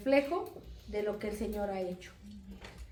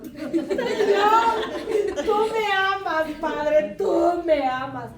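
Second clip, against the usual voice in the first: high, loud exultant cry to heaven; overjoyed cry; 7 semitones higher, 11 dB louder, much slower than usual. Retold or imitated. Retelling her thanks to God for free ice cream, exultant shout. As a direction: belt it out in comic, grateful rapture.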